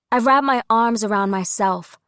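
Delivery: usual speaking voice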